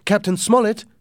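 high, loud call across the dark. A narrator's reading. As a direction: call out to the captain from outside. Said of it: "I called".

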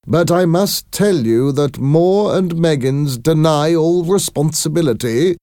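high, formal official voice; company visitor. A narrator's reading. Company man denying responsibility to parents, high and formal. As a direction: deliver the company line, pitched high and emphatic.

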